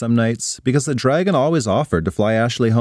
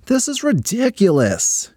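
complaining; 10 semitones higher than usual. Acted. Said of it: "she complained".

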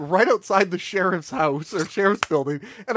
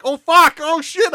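high, loud panicked swearing; acted-out alarm, pitched way up; retold panic; 10 semitones higher, 8 dB louder, slower than usual. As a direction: blurt it in a mock panic. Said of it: "I'm panicking".

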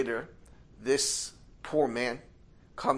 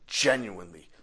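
plain reading voice

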